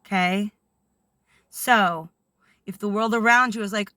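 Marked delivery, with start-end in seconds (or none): none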